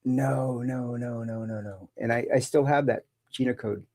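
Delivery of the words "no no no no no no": softer and softer denial; retelling her refusal to the chatbot, fading away; fading no's. Retold or imitated. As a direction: let each no sink quieter. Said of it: "And I'm like going".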